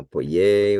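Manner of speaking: pollet with a long, loud final syllable; slow teaching pollet, accented at the end; stress on last syllable. Shown as a demonstration. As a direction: push hard on the end of pollet. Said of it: "it's always on that last syllable".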